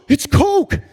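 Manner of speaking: shocked gasp. Acted. Finds drugs in pocket.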